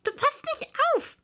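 high, stern little mouse voice. A narrator's reading. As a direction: scold Alice like a strict tiny mouse. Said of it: "sagte die Maus streng".

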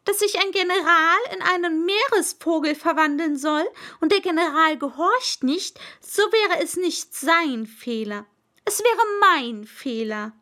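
high, loud royal voice; pompous king explaining his rule; lecturing king. Acted. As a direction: explain grandly as a wise monarch.

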